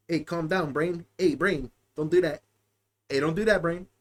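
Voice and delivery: low soft hushing; calm it down like a pet; low quiet mock soothing. Talking down to his brain.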